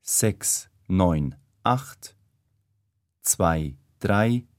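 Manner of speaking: ordinary talk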